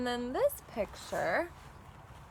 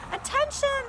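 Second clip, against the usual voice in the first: high, loud announcer call; announcement; 11 semitones higher, 4 dB louder than usual. A narrator's reading. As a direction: call the crowd to attention. Librarian on loudspeaker.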